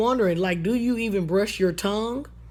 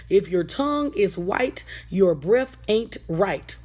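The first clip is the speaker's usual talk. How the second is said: teaching a rhyme; slower than usual. Shown as a demonstration. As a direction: recite it like a children's lesson, slowing on the second half. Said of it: "a rhyme that you can teach your children".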